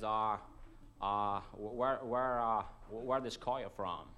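stammering mock American tourist; a halting Yank, slow and puzzled; lightly put-on American drawl; American accent. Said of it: "I apologise for the American accent".

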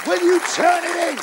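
high-pitched exasperated complaint; mock protest. Woman telling her lover to stop, exasperated. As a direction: pitch the complaint right up, hammed-up. Partner protests during sex.

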